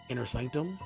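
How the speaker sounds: ordinary delivery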